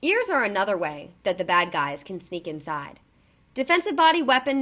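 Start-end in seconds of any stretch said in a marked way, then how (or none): none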